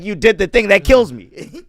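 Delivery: high, loud mock complaint; loud exasperated reply. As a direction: blurt it out, laughing at yourself. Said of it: "It's like".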